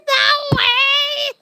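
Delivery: high, squealing laughter breaking into a long cackle; cracking up. Real, not acted. Streamer cracks up at a silly joke, squealing laughter. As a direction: shriek with laughter through the words.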